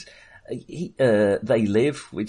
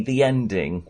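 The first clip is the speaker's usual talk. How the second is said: usual speaking voice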